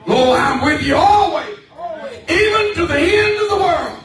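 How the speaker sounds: shouted scripture. Acted. Preacher quoting Jesus to the church, loud proclamation.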